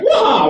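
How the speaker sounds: high, loud outraged cry; exasperated shout; mock yell. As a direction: blurt it out in disbelief. Mock outburst at attendant.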